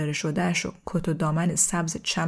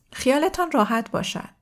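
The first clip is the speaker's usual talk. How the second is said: put-on higher-pitched kid's assurance; lightly voiced child; 6 semitones higher than usual. A narrator's reading. Child promising to fetch the adults, lightly voiced higher. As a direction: lift the pitch for a confident youngster. Child guest reassures Simon.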